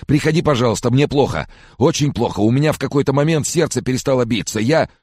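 high, loud, panicky whining; desperate plea. A narrator's reading. Sick man begs his friend to come over, desperate. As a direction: beg piteously, pitched high throughout.

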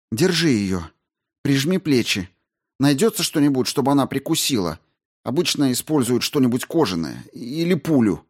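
high, brisk woman astrobiologist; Рао объясняет. Acted. Narrator voices Rao instructing. (высоким голосом, деловито и встревоженно)

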